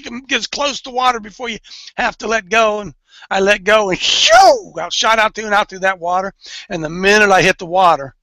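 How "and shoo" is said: whoosh sound. Retold or imitated. Imitating the slide whoosh.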